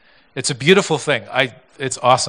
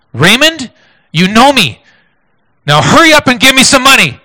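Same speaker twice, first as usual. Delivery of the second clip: much louder and higher, firm commanding call by name; clear firm voice; 11 semitones higher, 11 dB louder than usual. Retold or imitated. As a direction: cut through the slurring, sudden and certain. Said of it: "this clear, firm voice".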